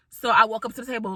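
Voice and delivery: ordinary talk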